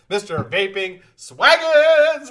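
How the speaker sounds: high, slow, drawn-out announcer call; grand announcer. Real, not acted. Streamer hailing a viewer's silly name, announcer style. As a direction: roll the name out like a ring announcer.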